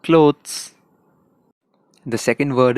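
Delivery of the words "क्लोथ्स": क्लोथ्स in an Indian accent, a touch higher. Shown as a demonstration. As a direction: Indian English clothes. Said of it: "and Indian में".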